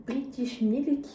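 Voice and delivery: slow, drawn-out mock English accent; put-on English accent. Vlogger reading the packet aloud, put-on English accent. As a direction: stretch it out in a playful English accent. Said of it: "je commence à faire mon accent".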